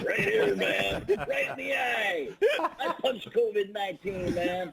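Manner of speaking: high, laughing tough-guy coach voice, slow at first; Jay Gruden impression. Shown as a demonstration. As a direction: ham up a fired-up coach, cracking up. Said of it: "ask me to do my Jay Gruden".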